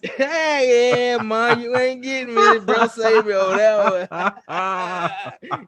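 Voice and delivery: loud, high playful taunt at mom; teasing shout at his mother; mock defiant shout. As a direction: crow it like you got away with it.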